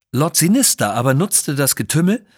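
ordinary delivery

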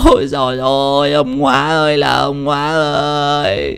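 slow lamenting wail, fading to a broken whisper; wailing widow. Acted. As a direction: mourn her husband, drawing each cry out. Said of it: "bà ôm mặt khóc rưng rức". (khóc than kể lể, chậm, nhỏ dần)

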